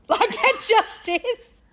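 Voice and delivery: high, slow laughing words. Real, not acted. Co-host amused by her own Robbie comparison, laughing.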